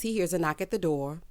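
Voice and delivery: ordinary talk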